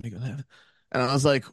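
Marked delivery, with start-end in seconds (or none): 0.0-0.4 very soft, low-energy mumble; barely talking. Retold or imitated. Driving examiner giving a direction, barely talking. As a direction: imitate someone barely audible. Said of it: "like, barely talking".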